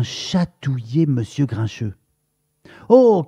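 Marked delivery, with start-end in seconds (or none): 2.9-3.2 high, loud startled oh; panicked yelp at the tickle; startled cry. A narrator's reading. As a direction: jump with fright. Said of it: "cria monsieur Grincheux, tout affolé".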